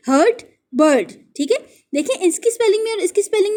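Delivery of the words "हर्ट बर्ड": हर्ट said to rhyme with बर्ड; same "ur" vowel in हर्ट and बर्ड; rhyming pronunciation. Shown as a demonstration. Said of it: "sound इसकी कैसी है? बर्ड जैसी". (हर्ट को बर्ड जैसी आवाज़ में बोलें)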